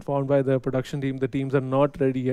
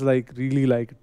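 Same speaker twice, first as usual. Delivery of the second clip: usual speaking voice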